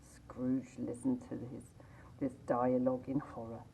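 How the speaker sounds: usual speaking voice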